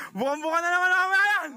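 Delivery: high laughing shout; laughing cheer. Real, not acted.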